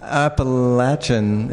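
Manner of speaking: "Appalachian" with LATCH, drawn out slowly; hometown pronunciation. Shown as a demonstration. Answering how he said it growing up, "Appalachian" as app-uh-LATCH-un. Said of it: "is how I said it growing up".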